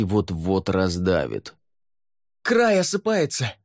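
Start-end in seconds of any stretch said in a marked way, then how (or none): 2.4-3.5 high-pitched shout of danger; distant alarmed call. A narrator's reading. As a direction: cry the warning up from below. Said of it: "донёсся до него голос Фэй".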